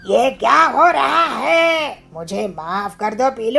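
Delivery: loud slow frightened cry; panicked shout. Acted. Kalu spinning in the air begs forgiveness, terrified. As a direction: cry out in terror.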